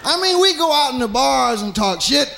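ordinary delivery

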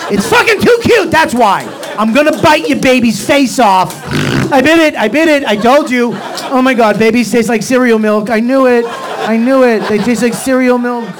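high, loud, manic affectionate rage; hammed-up baby-biting frenzy; rage love. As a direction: shout it in frenzied adoration. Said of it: "you ever rage, love?".

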